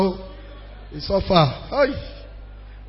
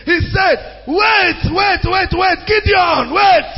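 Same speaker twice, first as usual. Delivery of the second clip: raised, urgent preacher's voice; preaching at full force; 7 semitones higher than usual. Acted. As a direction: cry it out to the whole congregation.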